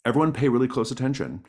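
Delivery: ordinary talk, nothing marked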